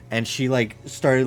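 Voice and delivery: usual speaking voice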